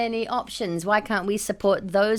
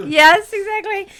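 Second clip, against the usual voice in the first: laughing; 13 semitones higher, 6 dB louder, slower than usual. Real, not acted. Laughing loudly at her friend's rude joke, high and loud. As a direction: burst of loud laughter, then a high, loud "Yes, exactly".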